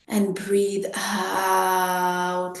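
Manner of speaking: very slow, stretched "out"; drawn-out breathing cue. Shown as a demonstration. Teacher paces the group's exhale, drawn-out breathing cue. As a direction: draw "out" along with the long exhale.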